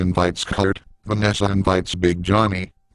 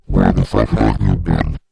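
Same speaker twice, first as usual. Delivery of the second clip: much lower and louder, a booming monster voice; monstrous dragon lady; 12 semitones lower, 4 dB louder than usual. Acted. The Dragon Lady teacher berates a student in a deep, booming monster voice. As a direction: growl it down low like a beast scolding a pupil. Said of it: "the dragon goes".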